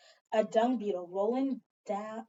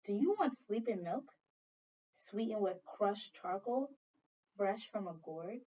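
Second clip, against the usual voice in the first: softer, slowing grandmother's question; gentle offer; 2 semitones lower, 7 dB quieter than usual. Acted. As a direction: coax the child softly.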